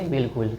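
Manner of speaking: बिल्कुल said softly and quickly, short उ; soft quick बिल्कुल with clipped उ; short easy vowel. Shown as a demonstration. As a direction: say बिल्कुल light and short, no stretch. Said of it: "कितना आराम से बोला गया".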